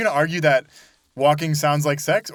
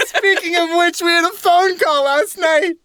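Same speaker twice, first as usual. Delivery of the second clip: high, slow teasing build-up; mock dramatic announcement; 13 semitones higher, 4 dB louder, slower than usual.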